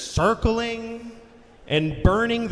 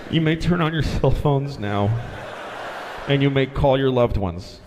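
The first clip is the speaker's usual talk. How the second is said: low, drained cabin announcement; mock-official announcement in low, winded phrases; out of breath pilot; 7 semitones lower than usual. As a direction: imitate a shaken pilot signing off. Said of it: "he's totally out of breath".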